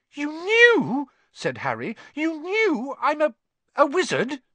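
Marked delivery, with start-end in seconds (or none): 0.1-1.1 put-on high, loud boy's disbelief; shocked boy. A narrator's reading. Harry shocked at his relatives. 2.1-4.4 high, loud, halting disbelief; shocked boy. A narrator's reading. Harry shocked at his relatives.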